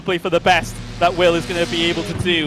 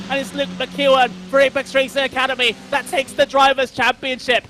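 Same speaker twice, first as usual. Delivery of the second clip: live play-by-play; 6 semitones higher than usual. Real, not acted.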